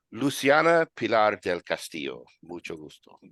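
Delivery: put-on grand Spanish name in a Spanish accent; match his poshness with Spanish flair; posh Spanish accent. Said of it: "given the poshness, I'm gonna say".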